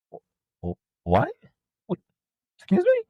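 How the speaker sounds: hushed stammer rising to a squeaky excuse me; stunned disbelief. Retold or imitated. Seller stunned by an angry customer, disbelieving. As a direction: act floored by the accusation. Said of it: "I was just like".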